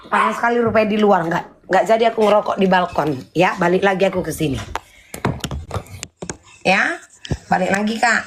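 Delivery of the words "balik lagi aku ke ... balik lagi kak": normal talking voice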